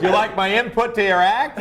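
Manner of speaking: high, loud mock heckler; proud heckler. Acts out a pleased heckler.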